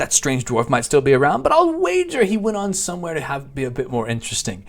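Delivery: high, lilting theatrical character voice; animated constable with big pitch swoops; chatty village constable. Acted. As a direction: play a friendly, hammed-up quest-giver. Reads the constable's quest text in character.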